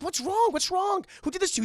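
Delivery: high, mock-alarmed questions; urgent high-pitched worry, acted out. Belle finds her captive father, alarmed.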